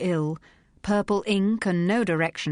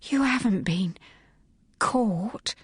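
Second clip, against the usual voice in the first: shocked hushed whisper; 4 semitones higher, slower than usual. A narrator's reading. Dot whispering her fear to Phryne, hushed. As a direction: whisper it as if it's scandalous. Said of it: "she breathed".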